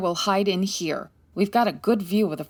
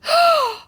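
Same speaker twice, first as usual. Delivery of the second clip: sharp, high, loud gasp; shocked gasp; 6 dB louder than usual. Acted. Candy gasps in shock at the body behind the sofa.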